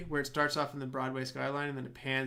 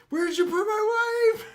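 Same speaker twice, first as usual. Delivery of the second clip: very high, loud mock crying cry; hammed-up wail; 10 dB louder than usual. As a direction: shriek it in fake hysteria. Husband shaking the doctor.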